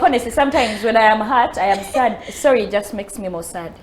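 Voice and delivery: ordinary talk, nothing marked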